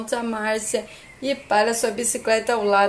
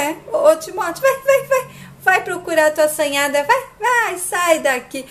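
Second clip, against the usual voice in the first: high, laughing, dismissive shoo; Márcia sends Timóteo packing, high and mocking; shooing him off; 7 semitones higher than usual. Márcia shoos Timóteo away.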